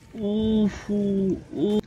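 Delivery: high, tense cooing at the map; high anxious ooh. Real, not acted. Gamer fretting over his encirclement, high nervous ooh. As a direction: sing out a worried ooh.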